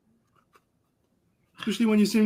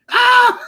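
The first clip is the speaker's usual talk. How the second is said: loud, high cry of agony, acted out; retold pained scream; 10 dB louder than usual. Co-host imitating a spicy chicken eater, pained scream. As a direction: imitate a man screaming from the bathroom. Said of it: "all you hear is fucking like".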